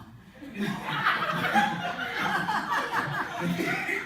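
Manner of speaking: long high rising laugh. Real, not acted. Preacher laughs at the ex-husband twist, high and long.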